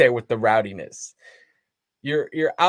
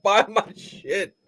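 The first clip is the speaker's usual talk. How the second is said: mock shouted plug; 9 semitones higher, slower than usual. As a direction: bark it like a joke sales pitch. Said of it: "You shoulda said".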